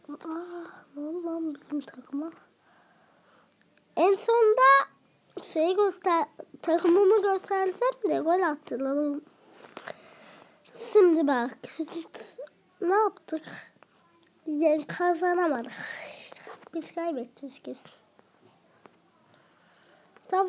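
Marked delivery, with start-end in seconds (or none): 0.1-2.3 whispering. Real, not acted. Dismay at conceding. 16.7-17.9 whispering. Real, not acted. Resigned after losing ball.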